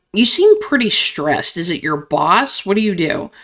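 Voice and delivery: ordinary delivery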